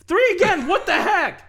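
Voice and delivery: very high, loud exclamation; loud, squawking incredulity; outraged disbelief. Real, not acted. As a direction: explode at yet another bad roll. Player rolls low again.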